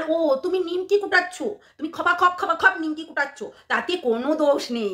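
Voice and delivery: ordinary talk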